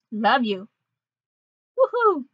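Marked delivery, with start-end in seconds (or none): 1.8-2.2 high, gleeful whoop; excited cheer. Acted. Character cheers after hearing love you, excited. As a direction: let out a high celebratory cheer.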